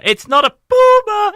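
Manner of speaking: puma mocked as POO-ma, high. Shown as a demonstration. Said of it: "when you say puma".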